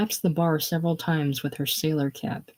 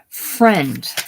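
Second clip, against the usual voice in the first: louder acted sob on one word; too tearful to manage more than a word; sobbed word; 5 dB louder than usual. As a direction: force the word out as if sobbing. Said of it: "Violet sobs. She can't talk".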